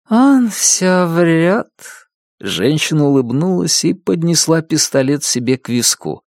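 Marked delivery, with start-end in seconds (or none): 0.1-1.7 very slow, high female voice; dazed accusing woman. A narrator's reading. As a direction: stretch it out woozily, pitched high.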